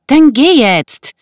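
high, loud, drawn-out demand; urgent order. Acted. Woman tells intruder to go now, urgent.

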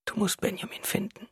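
weak, urgent whisper; whispered plea. A narrator's reading. Wife begs husband to find Benjamin, whispering. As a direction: press him in a whisper. Wife pleads after crying.

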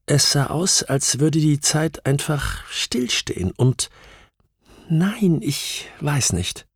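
high, slow, halting recollection; distressed witness, faltering. Acted. Witness losing the thread of her memory, slow and faltering. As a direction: trail into hesitation, pitched high.

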